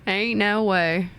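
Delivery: slow, drawn-out Southern drawl; Southern accent. Pretend. Streamer joking about reading stories aloud, Southern accent. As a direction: stretch the vowels in a playful Southern accent. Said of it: "read the stories in the Southern accent".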